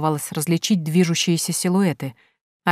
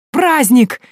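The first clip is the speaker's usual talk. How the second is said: high, loud happy squeal; a boy thrilled by a party; joyful squeal; 8 semitones higher, 7 dB louder than usual. A narrator's reading. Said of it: "радостно взвизгнул Прошка". (радостный визг мальчишки)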